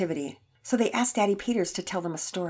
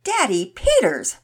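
slow, loud DADDY PETERS, higher and falling at the end; "Daddy Peters" slow and weighty, pitched up then dropping; emphatic; 5 semitones higher, 8 dB louder, slower than usual. A narrator's reading. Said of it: "Pearson said emphatically".

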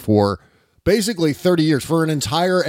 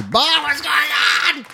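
frantic fan; 13 semitones higher than usual. Retold or imitated. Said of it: "sends me an email or a DM and says".

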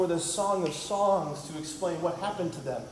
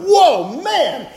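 amazed whoa; 6 semitones higher, 5 dB louder than usual. Retold or imitated. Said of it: "Adam looking at his newly fashioned naked bride Eve and going".

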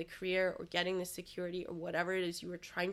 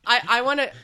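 loud, raised-pitch excited stammer; excited interjection; 5 semitones higher, 13 dB louder than usual. Real, not acted. Host cuts in with an episode idea, loud and excited. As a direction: burst in, loud and tripping over the start.

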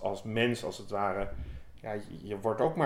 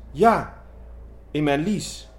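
"in mijn lies." repeated higher and louder, "lies" stressed; hysterical poodle; 4 semitones higher, 6 dB louder, slower than usual. Acted. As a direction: insist on it, working up to hysterics. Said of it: "nuffig tikje hysterisch poedeltje".

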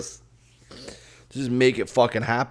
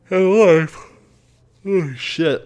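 higher, louder, caught mid-yawn; yawning while talking; 9 semitones higher, 9 dB louder, slower than usual. Real, not acted. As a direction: try to talk through a real yawn. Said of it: "I can't stop yawning".

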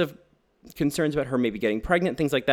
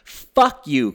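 loud, exasperated curse at the film; a loud fed-up swear; angry outburst; 2 semitones higher, 8 dB louder than usual. Real, not acted. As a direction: spit it out in real outrage. Outraged at the film.

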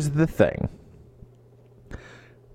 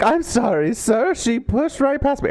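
flustered assistant; 10 semitones higher than usual. Retold or imitated. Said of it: "is like".

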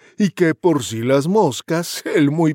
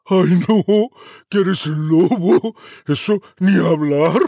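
voz de cerdito más aguda y fuerte, algo lenta; scared piglet; 5 semitones higher, 6 dB louder, slower than usual. Acted. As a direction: chilla asustado como un cerdito. Segundo cerdito rechaza al lobo. (segundo cerdito asustado rechaza al lobo en voz aguda)

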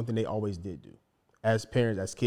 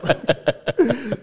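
laughing; 12 semitones higher, 8 dB louder than usual. Real, not acted. Laughs about dad not listening.

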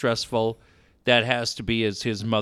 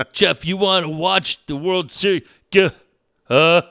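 higher, louder dazed mumble; mock dopey voice; 5 semitones higher, 5 dB louder than usual. Mimicking the ward for Dave, dazed mock voice. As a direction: play the drugged-up patient. Imitating the mental ward staff and patients.

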